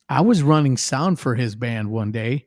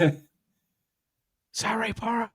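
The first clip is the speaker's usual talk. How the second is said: mock awed hush; 9 semitones higher, 7 dB quieter than usual. Kids whispering about the star.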